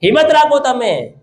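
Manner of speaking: high, insistent exhortation sustained phrase after phrase; forceful preaching. Real, not acted. Preacher telling burdened, suffering people to take courage, forceful preaching.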